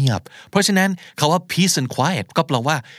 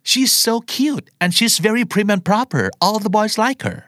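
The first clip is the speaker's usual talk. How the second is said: high-pitched, put-on gushing voice; gushing admirer; 9 semitones higher, much slower than usual. Admirer describing a cute, proper girl, gushing. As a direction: gush about her in a raised voice.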